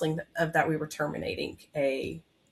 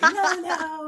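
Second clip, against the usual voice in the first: laughing no; 11 semitones higher, 4 dB louder than usual. Retold or imitated. Husband retorts, laughing.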